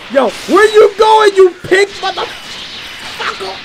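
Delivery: high, loud, slow yelling taunt; chasing Buu and yelling after him; shouted taunt. Acted. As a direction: holler at the fleeing pink enemy.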